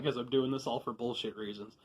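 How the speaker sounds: usual speaking voice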